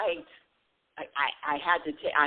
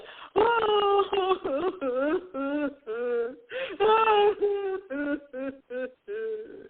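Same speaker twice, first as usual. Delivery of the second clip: long hammed-up boo-hoo wail; fake crying; 8 semitones higher than usual. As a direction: let out a high, badly faked sobbing wail. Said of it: "that was fake crying".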